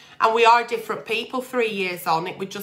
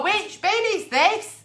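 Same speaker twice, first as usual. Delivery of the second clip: slow, higher, baffled "Which bin is this?"; mock-puzzled, slow and higher; confused asking; 8 semitones higher, slower than usual. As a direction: play a lost, bewildered person at the bins. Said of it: "and have to go".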